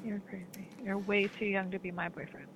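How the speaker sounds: ordinary talk, nothing marked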